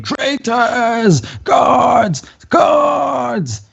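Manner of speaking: high drawn-out shout for help; screaming. Acted. Izek calling the guards on fleeing traitors, screaming. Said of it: "Izek starts to scream".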